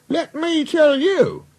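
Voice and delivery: very high, drawn-out indignant voice; indignant engine. Acted. Duck defending the railway, high indignation. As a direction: start a retort in a high, slow voice.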